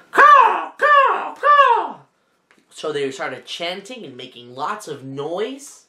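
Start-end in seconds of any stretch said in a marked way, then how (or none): 0.1-2.0 very high squawking caws; parrot caw. Acted. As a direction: screech like a parrot. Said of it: "And Papagayo shouted".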